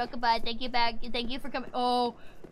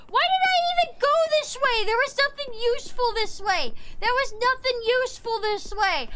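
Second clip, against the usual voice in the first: high, whining indignation; fed-up high-pitched tirade; exasperated rant; 5 semitones higher than usual. Real, not acted. Ranting about useless path.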